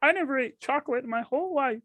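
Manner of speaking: mock Peterson warning about chocolate; Jordan Peterson impression. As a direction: lecture it in a thin quavering squeak. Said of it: "Jordan Peterson's like".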